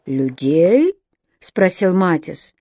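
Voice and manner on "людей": changed voice. Acted. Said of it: "голос его нельзя было узнать".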